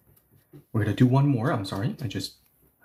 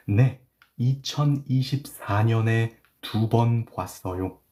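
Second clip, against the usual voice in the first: slow reading; much faster than usual. Shown as a demonstration. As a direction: read slowly, word by word. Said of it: "I will read it slowly".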